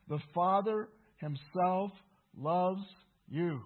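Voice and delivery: "loves" and "you" loud, each after a pause; slow, forceful declaration with "loves" set apart; emphatic statement. Real, not acted. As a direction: punch out "Father", "loves", "you" one by one. Restates verse as clear statement.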